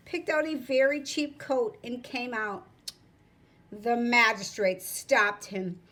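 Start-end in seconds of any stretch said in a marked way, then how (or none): none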